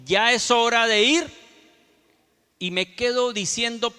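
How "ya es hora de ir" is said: loud, higher, slow imitated announcement; announcing voice. Pastor retells the voice that warned him to go, loud drawn-out announcement. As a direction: call it out slowly like an announcer. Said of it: "de esa voz que dijo".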